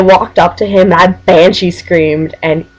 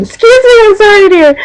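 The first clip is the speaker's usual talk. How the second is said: high-pitched playful pardon; exaggerated, high mock-polite apology; 4 dB louder than usual. Reader excuses herself for misreading a friend's name, mock-apologetic.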